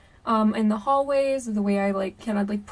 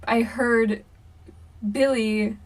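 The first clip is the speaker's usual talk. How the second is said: plain reading voice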